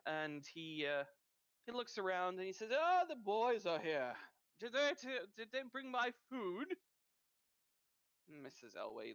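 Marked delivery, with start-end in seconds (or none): 2.7-6.7 higher, louder greedy townsman asking after his food; a pushy man showing up for his meal; greedy man's voice. Acted.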